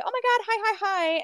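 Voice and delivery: high, bright chorus of hellos; excited greeting. Retold or imitated. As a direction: greet a friend all at once. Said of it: "we say".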